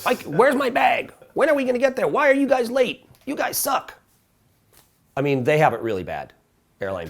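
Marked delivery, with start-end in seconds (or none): 0.0-3.9 high, whiny, entitled complaints; whiny passenger. Retold or imitated. Rude passenger griping at flight crew, high and whiny. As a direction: whine like a spoiled traveler. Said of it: "everyone's like".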